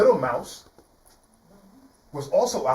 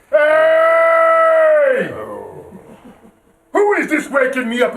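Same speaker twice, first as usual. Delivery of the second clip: loud, high shout of a startled lion; lion yelling at the mouse; lion roaring; 12 semitones higher, 11 dB louder, much slower than usual. Acted. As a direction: bellow like a woken lion. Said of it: "the lion woke up and said".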